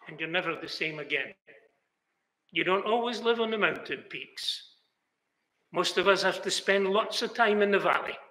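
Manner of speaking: usual speaking voice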